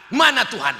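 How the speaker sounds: pressing, defiant questions; imitating challengers. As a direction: toss out a mock challenge, demanding proof. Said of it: "Nantang mereka".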